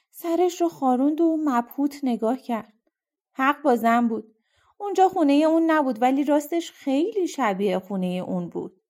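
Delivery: usual speaking voice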